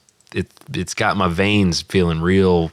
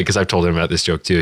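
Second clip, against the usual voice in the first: ordinary delivery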